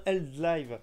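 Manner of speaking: confirmed reading, "Drive" as "dlive"; "Drive" drawn out as "dlaïve". Real, not acted. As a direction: say "Drive" slowly with L for R. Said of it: "je vérifie".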